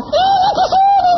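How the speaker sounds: very high, slow squeal of fright; frightened wife. Retold or imitated. Wife feels the demon on her back, high frightened squeal. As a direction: squeal it like a scared woman pointing. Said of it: "Istri saya bilang".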